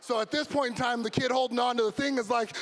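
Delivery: ordinary delivery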